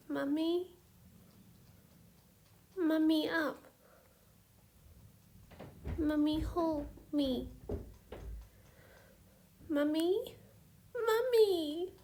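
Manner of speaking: put-on high, wailing little child's voice; a small girl calling desperately for her mummy; toddler crying for mummy. A narrator's reading. Toddler calling for absent mummy, high and wailing. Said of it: "Rosalind started to cry again".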